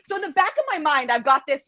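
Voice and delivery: ordinary talk, nothing marked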